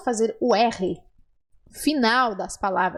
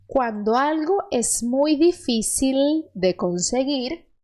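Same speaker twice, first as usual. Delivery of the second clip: very slow, clear dictation; slow model reading; much slower than usual. Shown as a demonstration. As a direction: read slowly for the learner to repeat. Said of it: "última frase".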